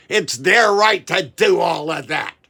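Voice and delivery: slow, loud mocking shout; sarcastic shout. Ranting to viewers, mocking Republicans with a slow loud shout. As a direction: bellow their claim back at them in mock outrage.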